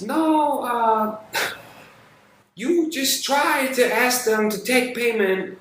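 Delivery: mocking high-pitched woman's voice; imitated demanding coworker. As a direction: hand down orders in a put-on high voice.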